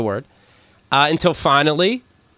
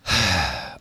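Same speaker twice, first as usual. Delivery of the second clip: soft put-on exhale; imitated girl's sigh; 9 semitones lower, 6 dB quieter than usual. Imitating a girl in bed, soft mock sigh. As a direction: sigh softly, as a demonstration. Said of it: "and she sighs".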